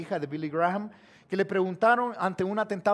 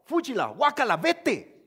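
mock rejection; 6 dB louder than usual. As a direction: shoo God away with exaggerated distaste. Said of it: "Ustedes le dijeron a Dios".

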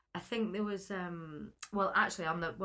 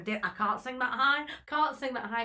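mild mock panic, higher and louder; retold panic; 5 semitones higher, 5 dB louder than usual. Singer realising mid-song the key is too high, mock panicking. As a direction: blurt it out like a panicked inner voice.

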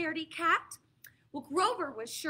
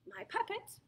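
usual speaking voice

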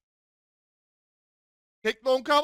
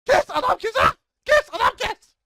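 much higher, louder urgent yell; frantic shout; 12 semitones higher, 7 dB louder than usual. Real, not acted. Gamer urging a teammate to finish the enemy, frantic shout. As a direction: scream the command, frantic.

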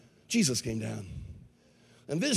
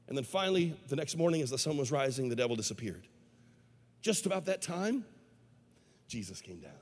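normal talking voice

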